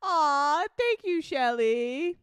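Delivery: normal talking voice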